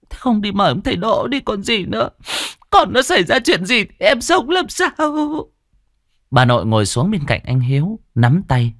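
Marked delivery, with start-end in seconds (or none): 0.1-4.9 high, urgent nagging woman; impatient aunt. Acted. Aunt urges her husband to fetch the shaman, high and urgent. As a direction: hurry her husband along, near panic. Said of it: "Thím Bích nóng ruột, giục chồng".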